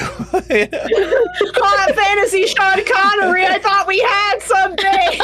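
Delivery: high, laughing mock wail; laughing mock heartbreak. Player laughing mock-heartbroken at the assassin NPC. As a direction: wail through laughter like a jilted lover.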